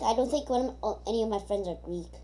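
ordinary delivery

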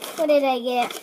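ordinary talk, nothing marked

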